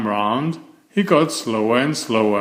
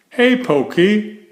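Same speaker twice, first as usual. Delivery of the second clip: high, louder teasing call; teasing greeting; 9 semitones higher, 4 dB louder than usual. A narrator's reading. Beaver greeting Franklin at the park, teasing. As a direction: call out to tease him. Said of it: "said Beaver".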